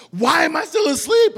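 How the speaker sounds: high, loud panicked cry; wife waking up late and yelling in alarm; alarmed shout. Retold or imitated. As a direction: wake up late and yell in alarm.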